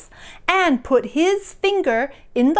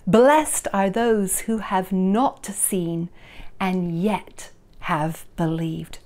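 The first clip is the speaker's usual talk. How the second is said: normal talking voice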